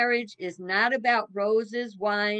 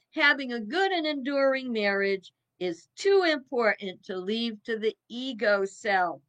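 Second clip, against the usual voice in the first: ordinary delivery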